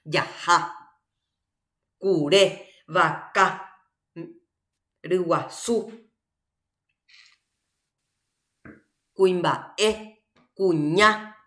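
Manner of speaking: Yajá, curé, vaca, riguazú, cuimbaé, cuñá, each leaning on its last vowel; final-vowel stress. Shown as a demonstration. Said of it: "donde nosotros cargamos nuestra fuerza de pronunciación".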